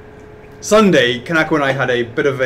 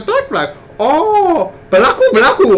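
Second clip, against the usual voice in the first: put-on much higher, laughing Japanese accent; 11 semitones higher, 4 dB louder than usual. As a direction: chirp it in a giggly Japanese accent. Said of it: "She goes".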